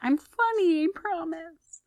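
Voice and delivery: high, small squeaky plea; mock pleading. Mock plea about missing jokes.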